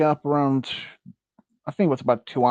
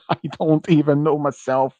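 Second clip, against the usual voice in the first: normal talking voice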